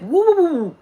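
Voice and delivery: high, loud playful woo-woo; sliding cry of woo; slipping whoops. Pretend. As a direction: yelp like you're losing your footing. Mimicking slipping on a banana.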